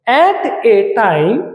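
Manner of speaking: at a time spaced out very slowly; slow repetition. Shown as a demonstration. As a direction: say at a time word by word. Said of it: "আমি আবারও বলছি". (থেমে থেমে, ধীরে at a time)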